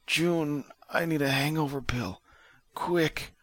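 weak, high, soft-edged plea; Vic. A narrator's reading. Injured man begs June for a pill, weakly. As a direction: plead feebly in a high, strained voice. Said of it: "Vic said weakly".

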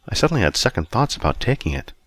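ordinary delivery, nothing marked

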